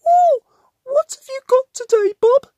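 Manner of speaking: high, eager little digger; Lofty asks Bob about his lunch, high voice; Lofty's voice. A narrator's reading.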